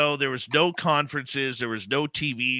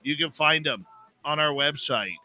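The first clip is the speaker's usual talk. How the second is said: measured, projected radio host opening; radio show intro; 2 semitones higher than usual. Acted. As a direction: welcome listeners around the world like a radio announcer. Radio host opens show over music.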